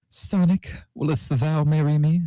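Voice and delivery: high proposal voice; a lightly voiced Sans on one knee; Sans proposing. A narrator's reading. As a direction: pop up high to ask. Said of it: "Sans asked".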